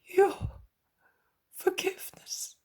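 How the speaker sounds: high, faltering plea; the man begs Jesus for forgiveness; moaning plea. Acted. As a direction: moan it, spent from weeping. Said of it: "he moans".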